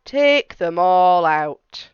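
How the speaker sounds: put-on slow, deliberate wise old man; measured advice from an old sage. Wise old man advising the lady, slow and deliberate.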